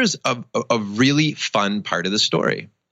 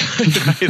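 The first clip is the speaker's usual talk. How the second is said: short real laugh at himself; amused laugh; 6 semitones higher, 4 dB louder than usual. Real, not acted. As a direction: laugh it off at your own expense. Laughing at his own parenting.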